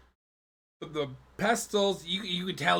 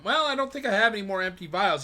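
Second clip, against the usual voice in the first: raised, quick merchant voice; a fussy shopkeeper's protest, higher and louder; 8 semitones higher, 6 dB louder, faster than usual. Acted. GM as merchant to the player, raised and quick.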